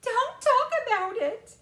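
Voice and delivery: very high, loud wail; mother's wail. A narrator's reading. Mother begging them to stop, wailing high. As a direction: wail it in distress. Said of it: "wailed Missus T V".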